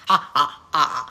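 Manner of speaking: cackling, tailing into emphatic ha, ha, ha; cackle. Real, not acted. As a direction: cackle ending in separate ha-has. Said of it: "The cackle".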